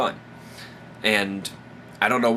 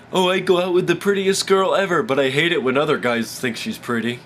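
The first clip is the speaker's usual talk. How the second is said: whiny put-on mimicry, pitched up at the start; mock a jealous guy's complaint; exaggerated jealous-guy impression; mocking jealous boyfriend; 4 semitones higher, 3 dB louder than usual.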